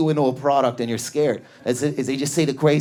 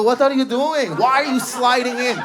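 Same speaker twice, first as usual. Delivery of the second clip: high-pitched flamboyant Persian man; sultry-silly flamboyant Persian impression; 6 semitones higher than usual. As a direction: imitate a showy Persian salesman, pitched up. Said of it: "Persians are the flamboyant Middle Eastern".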